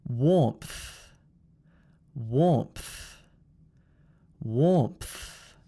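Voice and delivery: low "Warmth" with breath pushed out on th; MTH ending. Shown as a demonstration. Teacher models for repeating, "Warmth" with breath at the end. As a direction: push a little th out after m in "Warmth". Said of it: "the breath being pushed out at the end".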